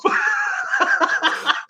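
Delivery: high-pitched laughing fit; laughter. Real, not acted. Storyteller recalls the court letting him off, laughing hard. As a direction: laugh hard at your own punchline. Laughing at his own punchline.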